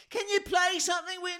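high squeaky request; lightly mocked girl. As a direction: squeak it like a timid punter. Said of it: "She went".

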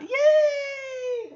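high, loud drawn-out squeal; real high-pitched cheer at good news; delighted cheer. Real, not acted. Host cheers the hat's return.

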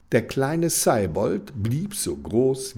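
ordinary delivery

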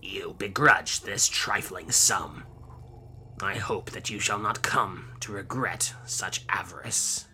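slow, sinister whispered threat; darkly. Acted. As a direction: whisper it like a dark curse. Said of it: "said darkly".

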